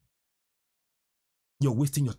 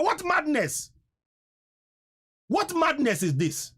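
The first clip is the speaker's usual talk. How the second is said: high, indignant outcry falling at the end; outraged disbelief; 9 semitones higher, slower than usual. Real, not acted. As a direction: cry out at the absurdity, pitched high. Outraged at praying instead of acting.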